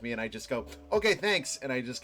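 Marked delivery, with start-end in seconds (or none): 0.9-1.6 high, loud dismissive thanks; fake-cheerful brush-off; quick brush-off. Brushing off the unhelpful clerk.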